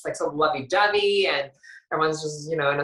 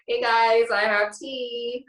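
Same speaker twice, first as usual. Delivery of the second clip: high, drawn-out mock gossip tease; gossipy announcement; 5 semitones higher, slower than usual. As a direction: announce juicy gossip in a raised, stretched voice. Acting out gossip delivered in pantry.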